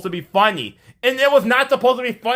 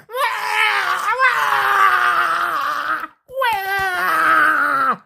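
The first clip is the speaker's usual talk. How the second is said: high mock wailing; mock crying; 10 semitones higher, much slower than usual. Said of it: "She was crying like".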